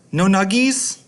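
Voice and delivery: put-on high pleading kid voice; high-pitched kid asking; childish whine. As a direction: ask like an indecisive little kid. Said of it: "the kid is very indecisive".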